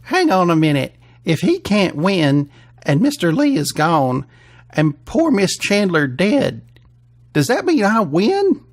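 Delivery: put-on hopeful good old boy; country contestant, dawning hope. Voices Pronger, the country contestant.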